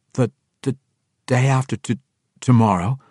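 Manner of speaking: stumbling over the words in shock; stammering drow captain, thrown by the news. Acted. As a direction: trip over each word, caught off guard. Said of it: "Tiago stammered".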